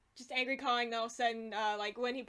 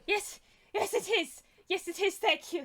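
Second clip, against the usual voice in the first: high-pitched, raised goblin voice; agitated small creature acknowledging sympathy; flustered goblin; 8 semitones higher, 5 dB louder than usual. Acted. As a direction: answer in a high, fussy little voice.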